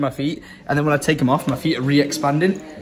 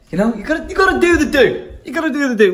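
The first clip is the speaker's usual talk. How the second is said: mock hype; 12 semitones higher, 5 dB louder than usual. Hyping himself to do the favor.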